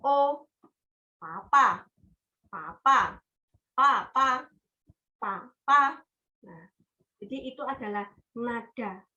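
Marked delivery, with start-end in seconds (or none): 1.2-5.8 "papa" said louder, falling sharply; fourth tone. Shown as a demonstration. Teacher showing the falling fourth tone with "papa". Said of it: "kalau nada keempat".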